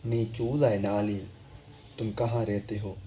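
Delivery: usual speaking voice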